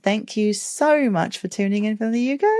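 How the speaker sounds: put-on a little British accent sign-off. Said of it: "I did have to end on a little British accent there".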